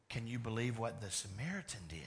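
ordinary talk, nothing marked

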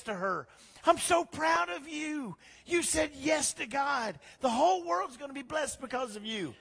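high, loud, joyful proclamation; loud exclaiming. Acted. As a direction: cry out a blessing at full voice. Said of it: "In a loud voice, she exclaimed".

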